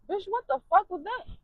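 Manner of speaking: can't believe what happened; stunned outrage. Acted. Shocked outburst ending the story.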